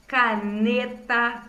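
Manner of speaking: "Caneta" stretched slowly into ca-ne-ta; syllable by syllable. Shown as a demonstration. Teacher showing pupils the middle syllable, "Caneta" syllable by syllable. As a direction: say "Caneta" slowly, each syllable set apart. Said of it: "Reparem que as sílabas com N".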